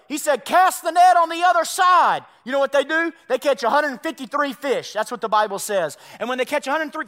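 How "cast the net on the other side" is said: loud call from the shore; shouted instruction. Retold or imitated. Jesus tells the disciples where to fish, shouted. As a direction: order it across the water.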